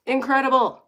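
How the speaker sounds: high, loud excited cheer; proud cheer. Real, not acted. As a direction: celebrate getting it right. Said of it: "I said a word right".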